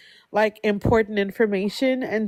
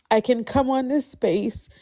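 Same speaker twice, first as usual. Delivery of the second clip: ordinary talk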